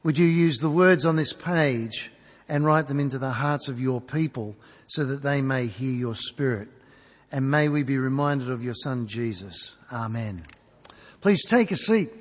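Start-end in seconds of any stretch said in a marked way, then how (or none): 0.0-10.4 earnest spoken prayer to the Father, slowing at the end. Acted. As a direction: pray it sincerely, letting it settle. Opening prayer before sermon.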